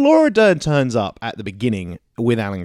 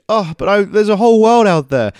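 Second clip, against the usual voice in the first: higher, louder whiny plea; mock a dramatic teenager; mock teen plea; 9 semitones higher, 7 dB louder than usual. Mocking the clone girl pleading to leave, high and loud.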